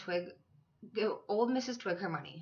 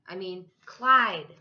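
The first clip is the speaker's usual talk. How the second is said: louder and slower, making sure she hears; loud, slow repeat; 11 dB louder, much slower than usual. Acted. Clyde repeats his name loudly for Gloria, slow and loud. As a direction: say it clearly this time.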